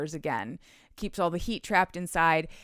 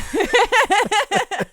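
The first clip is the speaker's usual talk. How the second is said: high, loud real cackle; loud burst of laughter; 14 semitones higher, 10 dB louder than usual. Real, not acted. Host cracking up at her own "great for later", high laughing. Cracks up after sponge floor innuendo.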